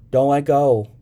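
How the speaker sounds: high, loud, urgent command; child's order. A narrator's reading. Sybil to the young man on the float, ordering. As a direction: order it like an anxious little girl. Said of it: "Sybil ordered".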